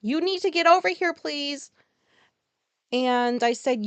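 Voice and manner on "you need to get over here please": calling out loud and high across the house; retold yell. Calling her girlfriend from the next room, loud. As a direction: yell for her to come over now. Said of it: "I yelled for Alicia".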